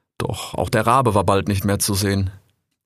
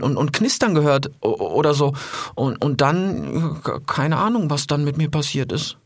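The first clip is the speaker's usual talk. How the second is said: stammering and dazed on waking; groggy; 7 semitones higher than usual. Acted. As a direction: stammer like just woken from poison. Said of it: "immer noch ganz duselig".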